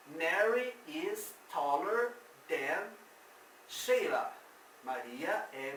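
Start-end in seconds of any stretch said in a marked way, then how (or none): 0.1-4.3 slow English, Mary said most strongly; slow model sentence. Shown as a demonstration. Teacher modeling a comparison for students, slow. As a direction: draw the sentence out for the class.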